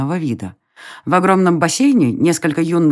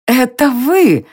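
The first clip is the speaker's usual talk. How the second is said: high, slow surprised cry; surprised exclamation; 8 semitones higher, 3 dB louder, much slower than usual. A narrator's reading. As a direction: exclaim it in amazement. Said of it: "удивлённо воскликнула она".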